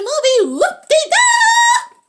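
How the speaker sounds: high, loud celebratory shout; a loud, high whoop for the end of the movie; loud cheer. Real, not acted. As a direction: cheer the ending like a party.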